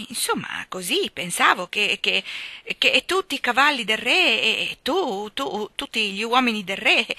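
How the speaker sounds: halting, flustered stammer with repeated words. A narrator's reading. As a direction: fumble for words, stretching and repeating them. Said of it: "cominciava a balbettare".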